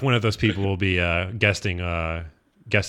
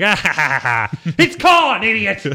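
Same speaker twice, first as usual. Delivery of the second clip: mock scornful laugh and yell; 7 semitones higher, 4 dB louder, slower than usual. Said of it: "I'm just like".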